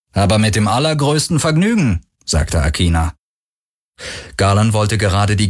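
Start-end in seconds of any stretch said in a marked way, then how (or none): 0.1-2.0 hearty agreement. A narrator's reading. Captain gladly accepts the passenger.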